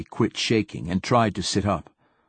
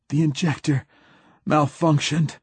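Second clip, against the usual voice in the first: higher, strained gasp in pain; 7 semitones higher than usual. Acted. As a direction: push the words out through pain. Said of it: "gasped Hoyt".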